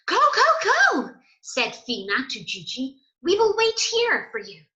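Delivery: very high and loud, fast; excited urging. Acted. Fina urging Gigi into the film tent, high and loud. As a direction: cheer him on high.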